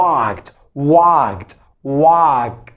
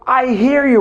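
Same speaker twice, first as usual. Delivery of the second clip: mock shout; 7 semitones higher than usual. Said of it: "you don't have to shout".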